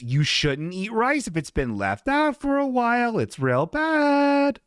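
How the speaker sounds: high, slow, strained warning voice; squeaky, drawn-out mock warning to the audience; silly put-on voice. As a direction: stretch "real bad" way up and slow. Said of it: "why I gave her that voice".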